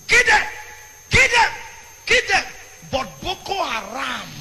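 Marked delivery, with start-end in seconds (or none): 0.1-2.4 shouted, much higher and louder; mocking the kill order. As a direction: yell it like a brutal command. Voicing government's kill order.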